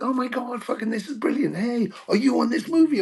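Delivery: high, thrilled exclamations; excited surprise. Retold or imitated. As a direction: gush like you can't believe your luck. Colleagues thrilled to see him.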